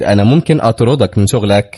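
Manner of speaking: plain reading voice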